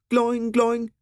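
high springy gloing; boing the ball in a high voice; ball bounce noise. A narrator's reading.

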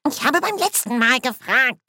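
high-pitched protesting dwarf; muttering dwarf. A narrator's reading. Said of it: "Leise Kommentare waren zu hören".

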